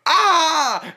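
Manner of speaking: short high loud shriek; a sudden gasp-scream of shock; startled scream. Retold or imitated. Greaser on stage seeing the wig fall, startled loud shriek. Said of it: "you literally scream on stage".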